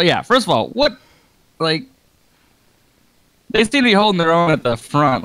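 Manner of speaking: usual speaking voice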